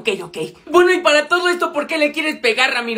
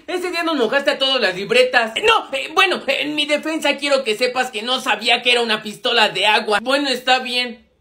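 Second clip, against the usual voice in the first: usual speaking voice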